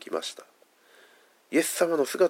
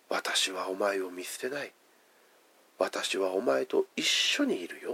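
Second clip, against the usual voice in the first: softer, slower, gently comforting; soft tender reassurance; 5 semitones lower, 7 dB quieter than usual. Retold or imitated. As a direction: speak it like quietly holding a dying man's hand. Preacher voices Jesus comforting man.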